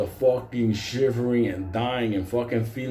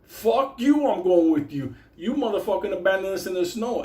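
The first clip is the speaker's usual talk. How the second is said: high, indignant retold outburst; angry refusal; 7 semitones higher than usual. Abandoned man refusing the assassin, mock fury. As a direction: burst out high, then rant.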